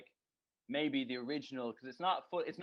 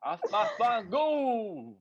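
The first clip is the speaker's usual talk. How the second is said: higher, louder mock Italian-American gibberish; hammed-up mobster picking a fight; mock Italian fighting words; 9 semitones higher, 8 dB louder, much slower than usual. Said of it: "get in a fight with some real Italian".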